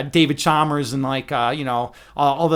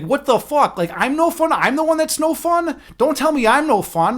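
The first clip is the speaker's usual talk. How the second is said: high-pitched, incredulous comeback; mock-offended protest, voice pitched up; indignant retort; 8 semitones higher, 4 dB louder than usual. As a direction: throw the accusation back, amused outrage. Mock retort to the drunk woman.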